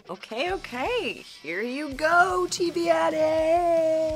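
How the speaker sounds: very slow stretched-out words. Shown as a demonstration. Host granting a viewer's slow-mo request, slow motion speech. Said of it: "I want a slow-mo of that".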